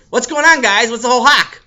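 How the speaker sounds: loud high greeting; loud kid. Acted. Newcomer to the boys, loud. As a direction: call out to the guys. Beryl arrives loudly.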